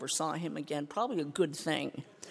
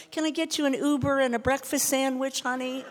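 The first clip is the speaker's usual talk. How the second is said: higher, louder sugary offer; mock sweet helper; 7 semitones higher, 7 dB louder than usual. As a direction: overdo the kindness of a gentle sponsor. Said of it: "We're like".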